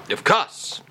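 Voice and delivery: a jaunty French "Of course"; mock French accent. [name] answers about the supermarket trip, in a mock French accent.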